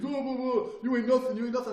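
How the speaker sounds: loud, high mock taunting; talking shit. Guy taunting from across the room, trash-talking. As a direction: imitate a mouthy aggressor jeering. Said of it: "He was talking shit".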